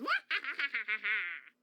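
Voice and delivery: loud breaking laughter; burst of laughter. Real, not acted. Breaks up after the line.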